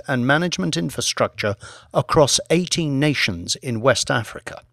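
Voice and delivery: ordinary delivery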